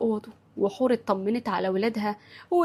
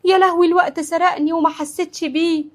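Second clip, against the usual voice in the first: higher, louder flustered outcry; startled alarm; 6 semitones higher, 7 dB louder than usual. A narrator's reading. Mother realises she forgot the candle tray, startled alarm. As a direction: cry out in sudden panic over lost time. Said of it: "هدى قامت منطورة".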